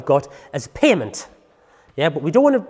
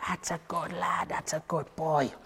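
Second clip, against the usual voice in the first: soft praise; 13 dB quieter, slower than usual. Real, not acted. Praising dog softly.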